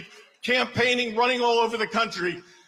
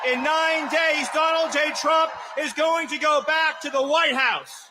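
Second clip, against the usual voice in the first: rally speech; 5 semitones higher than usual. Acted. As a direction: proclaim it to a packed arena.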